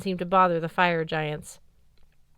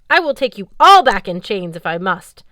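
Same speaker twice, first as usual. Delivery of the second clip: much louder, menacing threat; 11 dB louder than usual. Acted. Valkyrie captain loudly threatening everyone. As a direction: promise the chains hard.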